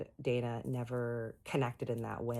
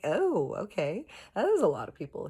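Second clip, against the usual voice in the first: surprised; 7 semitones higher, 5 dB louder than usual. Retold or imitated. Shocked reply to his answer.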